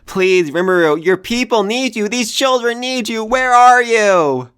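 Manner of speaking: real desperate plea rising to a call. Real, not acted. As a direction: cry out to Rimuru as if he could hear. Begging Rimuru to come back.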